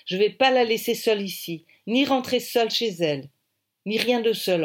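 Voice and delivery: firm refusal. A narrator's reading. Heroine firmly refuses to leave.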